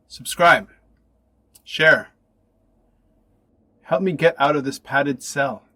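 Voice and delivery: normal talking voice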